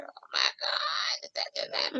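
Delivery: gasped, breathy whisper of a spooked person; startled whisper. Retold or imitated. Picturing a viewer's first spirit sign, startled whisper. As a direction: whisper as if you just saw the spirit move something. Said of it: "it's gonna startle you just a little bit".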